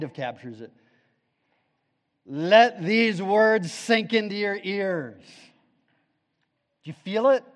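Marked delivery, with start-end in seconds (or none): 2.3-7.4 preaching. Acted. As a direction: press the verse into their ears. Quoting scripture with force.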